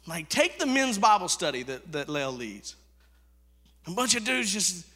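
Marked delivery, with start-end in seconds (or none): none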